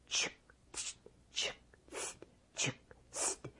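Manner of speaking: very soft unvoiced cutting clicks; hushed blade sound; whispered chick tsk. A narrator's reading. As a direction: whisper the knife strokes. Knife cutting sound effect.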